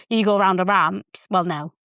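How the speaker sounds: ordinary talk, nothing marked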